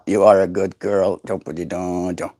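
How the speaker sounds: low mumbled parrot chatter; mimicking a parrot. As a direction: babble like the bird.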